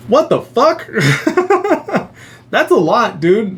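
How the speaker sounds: high, laughing what the fuck; cracking up in shock; laughing disbelief. Real, not acted.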